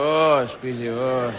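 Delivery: lightly voiced chef, low and cooing; mock chef squeezing. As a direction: coo over the fruit like a TV chef. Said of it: "where you go in and go".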